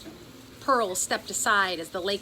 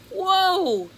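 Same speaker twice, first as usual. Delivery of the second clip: startled cry; 11 semitones higher, 8 dB louder than usual. A narrator's reading. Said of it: "she cried".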